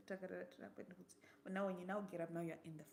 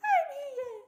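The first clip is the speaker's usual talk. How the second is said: high, loud call back with a laugh; answering shout; 11 dB louder than usual. Retold or imitated. Sister inside calling back to the searcher, loud and high with a laugh.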